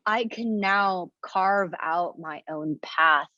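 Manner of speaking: ordinary talk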